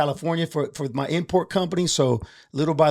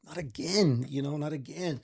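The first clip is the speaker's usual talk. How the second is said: softer, falling apart inside; a man breaking down, much softer; despairing, much softer; 9 dB quieter than usual. Retold or imitated. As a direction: let it drain out of you, barely there. He broke down in kitchen.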